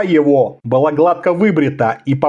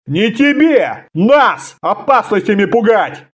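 high, indignant military boasting; hot-tempered; 7 semitones higher than usual. A narrator's reading. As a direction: boast angrily of past battles. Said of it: "вспылил офицер".

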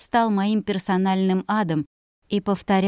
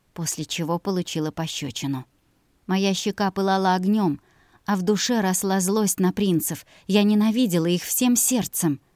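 usual speaking voice

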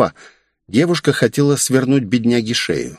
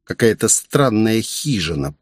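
ordinary delivery, nothing marked

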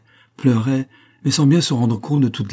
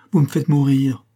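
exclamation plus haute et plus forte; s'écrier, à bout, sans hurler; cri éperdu; 5 semitones higher, 5 dB louder than usual. A narrator's reading. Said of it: "s'écria Armande". (cri d'angoisse contenu)

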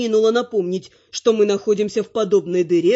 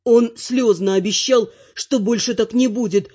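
usual speaking voice

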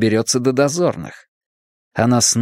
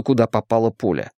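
ordinary delivery

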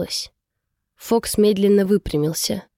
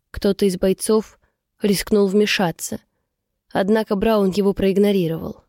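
usual speaking voice

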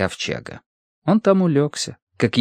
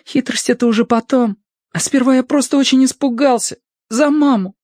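much higher character voice, confessing fear; Notka, candid and frightened; 13 semitones higher, 4 dB louder than usual. A narrator's reading. Notka admits fear for mama, high earnest character voice. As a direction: pitch it high for an earnest, scared little figure.

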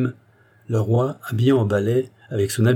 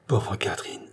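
dying murmur; 2 semitones lower, 6 dB quieter than usual. A narrator's reading. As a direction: murmur it faintly as a wounded man. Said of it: "on l'entendit murmurer". (murmure de mourant, voix basse)

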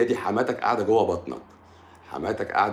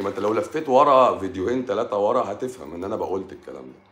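usual speaking voice

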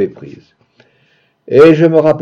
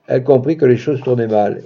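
usual speaking voice

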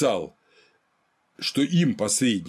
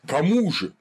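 indignant question, pitched higher; mild narrated cry; 5 semitones higher than usual. A narrator's reading. Nobles calling out to the mediator who defied them, mild cry. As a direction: read it as a stung crowd's outcry, restrained. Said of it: "как ужаленное, крикнуло".

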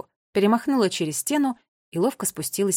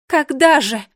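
high, loud call; raised, urgent exclamation; exclaimed question; 5 semitones higher, 9 dB louder, slower than usual. A narrator's reading. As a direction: cry out after them. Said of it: "воскликнула королева Кникс".

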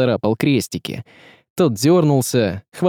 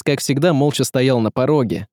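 usual speaking voice

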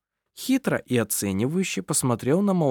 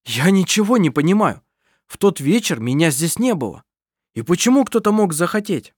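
bewildered outburst; 6 semitones higher, 5 dB louder than usual. Acted. Adrian bewildered, looking around wildly.

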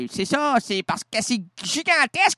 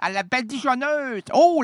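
ordinary delivery

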